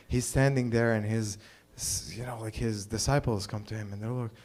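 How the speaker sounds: ordinary talk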